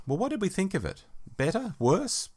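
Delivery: normal talking voice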